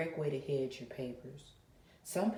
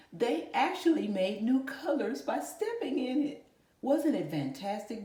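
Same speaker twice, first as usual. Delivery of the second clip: higher and louder, thrilled storyteller; excited; 8 semitones higher, 7 dB louder than usual. Acted. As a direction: sell the wonder of the story to kids. Teacher thrilled about story.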